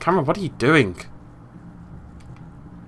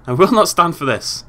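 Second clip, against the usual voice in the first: usual speaking voice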